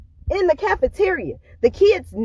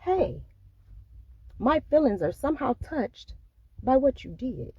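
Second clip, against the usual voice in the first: soft, polite and careful, much quieter; respectful and gentle; 9 dB quieter than usual. Retold or imitated. Telling a friend she's hurt, softly and respectfully. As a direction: calmly raise a hurt feeling without blame. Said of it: "in a respectful manner".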